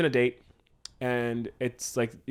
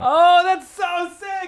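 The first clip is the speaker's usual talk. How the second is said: much higher, louder, drawn-out delight; excited; 7 dB louder, much slower than usual. Real, not acted. Writer thrilled by partner's twist, much higher and louder. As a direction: let the praise burst out.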